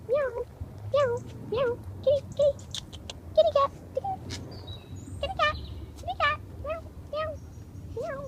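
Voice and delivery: high coaxing pet call; sing-song call to lure the cat; calling kitty. Real, not acted. Calling the cat over in a high coaxing voice.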